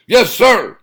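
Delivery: high, loud eager shout. Acted. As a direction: snap to attention and shout. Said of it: "shouted Weasel".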